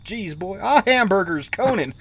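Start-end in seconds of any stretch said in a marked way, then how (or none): none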